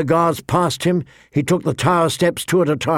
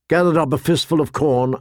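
ordinary delivery

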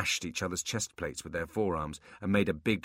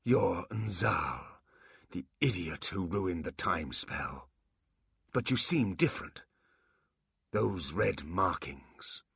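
slow, breathy remembering; slow whispery recall; much slower than usual. Acted. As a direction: dredge it up slowly. Quan recalls, slowly.

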